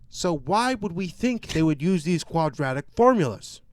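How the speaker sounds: mocking Kevin Spacey's teacher character, pompous and loud; pompous professor. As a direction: lord it over the class like a smug teacher. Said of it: "he just sounds like a fucking pompous asshole".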